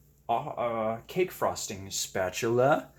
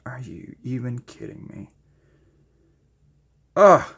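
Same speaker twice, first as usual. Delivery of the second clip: usual speaking voice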